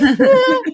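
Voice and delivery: high delighted squeak; starstruck squeal. Retold or imitated. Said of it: "And I'm like".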